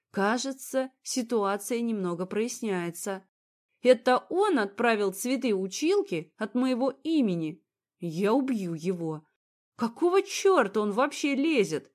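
usual speaking voice

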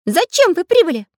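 high-voiced challenge from a small character; threatening. A narrator's reading. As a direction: demand it high, with menace. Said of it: "с угрозой спросил Карандаш".

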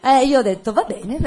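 high, loud, laughing delivery; amused, laughing as you tell it. Real, not acted. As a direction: talk through a burst of laughter. Laughs while telling her own story.

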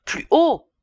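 high, loud shout; teacher shouting for him to speak up. Acted. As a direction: yell at the mumbling boy. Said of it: "cria le maître".

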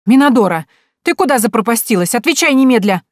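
громко и высоко, требовательно; звать из дома, строго и громко; взволнованный окрик. A narrator's reading. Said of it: "взволнованный голос Степаниды". (громкий окрик хозяйки из дома)

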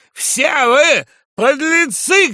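high, loud, drawn-out insult; furious shout. A narrator's reading. Drunk journalist is thrown out and curses all, furious shout. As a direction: bellow the insult slowly. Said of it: "кричал сотрудник".

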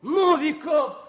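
high, bright call of a surname across the ward; joyful calling out. Acted. Officer calls his friend's surname in joyful surprise. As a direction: hail him with delight. Friends greet each other loudly.

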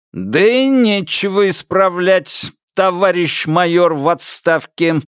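crisp, measured pronouncement. A narrator's reading. Алик addresses the supposed eavesdropper, measured ringing voice. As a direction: hammer out every word deliberately. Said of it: "чеканным голосом проговорил Алик".

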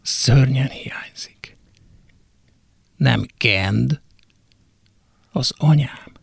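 soft whispered admission; hushed confession. Acted. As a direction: say it barely above breath. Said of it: "azt mondanám".

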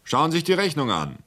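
high-pitched insistent order; indignant demand. A narrator's reading. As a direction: insist with a swooping, heated rise. Angry seller points to bill.

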